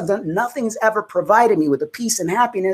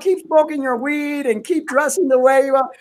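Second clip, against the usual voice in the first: over-welcoming church; 7 semitones higher than usual. Retold or imitated. Mimicking a church telling everyone to come on in, mock-welcoming. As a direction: imitate an all-too-welcoming church, pitched up.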